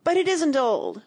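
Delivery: high, loud outraged protest; furious cry. A narrator's reading. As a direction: burst out in a fury. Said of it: "cried in a greater fury than ever".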